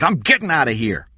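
high, loud, agitated burst; shouting. A narrator's reading. Eddie walks out on Kathy, shouting. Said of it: "he shouted".

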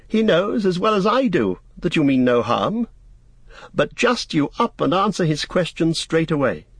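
put-on high-pitched young master; reassure, then urge an answer, high voice; Frodo, amused and reassuring.

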